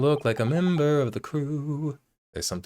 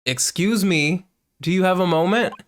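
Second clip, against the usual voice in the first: raised, higher-pitched polite demand; insistent calling out; 8 semitones higher, 6 dB louder than usual. Acted. Calling again after being ignored.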